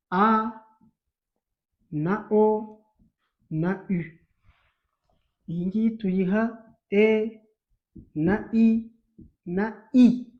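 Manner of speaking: vowels called out loud; teacher calling out vowels; loud vowel drill. Shown as a demonstration. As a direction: list the vowels loudly for the class. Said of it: "teacher listing vowels".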